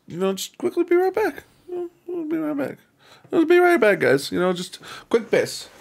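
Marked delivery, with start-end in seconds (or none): 0.1-1.4 singsong high voice. Pretend. Singsong before leaving stream. 1.7-2.8 soft high falsetto; high soft singsong. Pretend. Repeats in a different voice. 3.3-4.0 louder high falsetto call; high singsong call. Pretend. Repeats in a different voice.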